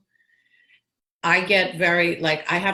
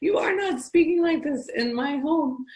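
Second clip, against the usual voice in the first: lightly voiced Irish mother, higher and stern; a hint of a strict Irish mom; Irish mom scolding; 9 semitones higher than usual. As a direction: mom scolding her kid for the accent, mild mock. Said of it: "My mom would be like".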